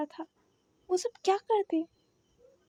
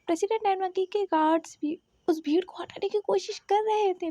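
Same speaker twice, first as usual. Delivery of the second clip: ordinary delivery, nothing marked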